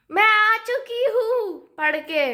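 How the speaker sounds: excited loud homecoming; loud announcement. Real, not acted. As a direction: announce it loudly. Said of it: "इतनी ज़ोर से क्यों बोल रही हूँ".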